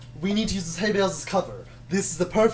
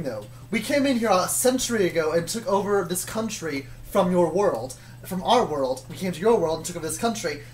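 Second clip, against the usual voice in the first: ordinary talk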